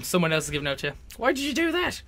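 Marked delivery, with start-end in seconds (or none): 1.2-2.0 high-pitched exasperated demand; imitated telling-off, raised in pitch; mock scolding. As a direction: put on a scolding parent's higher voice. Quoting a scolding mother or teacher.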